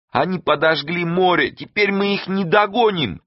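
high-pitched lament; despairing wail. A narrator's reading. Саадат fears losing the fugitives, wailing. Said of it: "простонала Садат".

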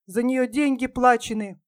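usual speaking voice

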